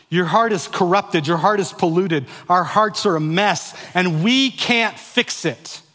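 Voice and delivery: high, loud preaching, slowing to a crawl; preaching at full force. Acted. Pastor telling the congregation they can't fix their hearts. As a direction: drive the hopelessness home slowly.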